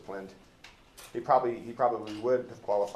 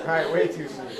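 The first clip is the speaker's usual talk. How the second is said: much higher and louder, talking through embarrassed laughter; laughing; 8 semitones higher, 15 dB louder than usual. Real, not acted. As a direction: laugh it off and push on to the text. Teacher laughing off awkward joke.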